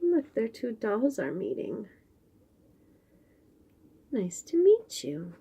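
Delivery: ordinary delivery, nothing marked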